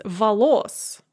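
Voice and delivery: волос stressed on the final syllable, vo-LOS; stress on second syllable. Shown as a demonstration. Professor showing students the genitive plural, волос stressed on second syllable. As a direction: hold back, then land on LOS in волос. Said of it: "with the stress on the second syllable".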